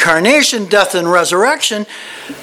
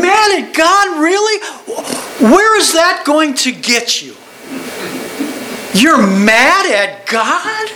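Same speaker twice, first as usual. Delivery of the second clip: incredulous; 5 semitones higher than usual. Retold or imitated. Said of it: "I'm like".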